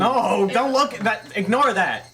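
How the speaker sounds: high, loud, urgent objection; panicked protest. Real, not acted. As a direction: stop him in a hurry. Frantically stops him.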